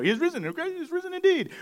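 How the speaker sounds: Easter call and response. Retold or imitated. Pastor imitates the Easter greeting the church says, high and bright. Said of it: "we say, you know".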